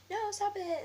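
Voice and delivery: high, loud mock cry to stop; retold teacher's yell. Teacher breaking up a fight, high imitated yell.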